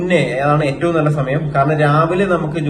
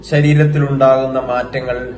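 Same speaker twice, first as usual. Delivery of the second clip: guided meditation; 3 semitones lower, 6 dB quieter than usual. Acted. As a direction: guide them into stillness, phrase by phrase, in an even tone. (ധ്യാനത്തിലേക്ക് നയിക്കുന്ന സാവധാന ശബ്ദം)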